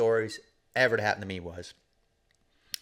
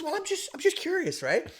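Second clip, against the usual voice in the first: much higher, whiny mock apprentice; defensive young guy. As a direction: plead it like a caught kid. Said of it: "He goes".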